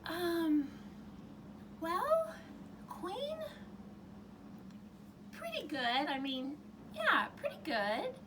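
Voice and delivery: high, unsure princess voice; hesitant princess. Acted. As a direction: hedge politely, very high. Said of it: "the princess said".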